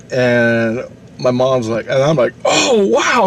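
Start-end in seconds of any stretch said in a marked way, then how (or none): 2.4-3.3 high-pitched wowed exclamation; amazed kid. Retold or imitated. Kid hearing about the Sasquatch, amazed. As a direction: re-enact wide-open wonder, pitched way up. Child's amazed reaction retold.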